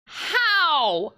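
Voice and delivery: high, drawn-out shout of disbelief; shrill incredulous yelling; incredulous outburst. Real, not acted. Outraged reaction to absurd game witness.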